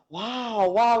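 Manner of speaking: gasp, then an impressed wow; amazed gasp. Retold or imitated. Co-host reenacts listening to the mice research, amazed. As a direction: act out being wowed by what you hear. Acts out awed listening reaction.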